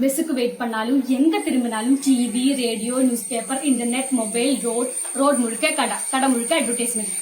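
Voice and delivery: usual speaking voice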